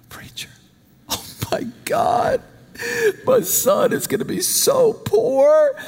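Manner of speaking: high, slow wailing mother's lament; mom crying. Retold or imitated. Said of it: "she's now crying about that".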